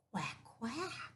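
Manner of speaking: soft duck quack. Acted. George makes duck sound.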